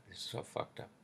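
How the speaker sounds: breathy whisper of defeat; real weary anger, whispered; whispered disgust. Real, not acted. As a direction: let it fall away under the breath. Ranting about being swindled.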